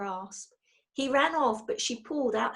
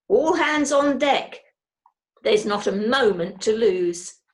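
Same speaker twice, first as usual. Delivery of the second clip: commanding call; 8 dB louder than usual. A narrator's reading. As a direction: bark it like a captain. Mrs Bird commanding.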